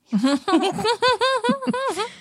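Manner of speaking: long, high real laugh; high-pitched giggle. Real, not acted. Reader amused by her dropped-dinner story, giggling.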